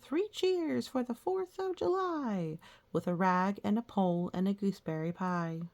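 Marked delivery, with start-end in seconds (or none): 0.0-2.6 put-on much higher, excited little boy cheering; boy's cheer. A narrator's reading. Reading a poem to a child, the boy cheers high. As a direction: cheer it out like a thrilled small boy. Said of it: "He cried".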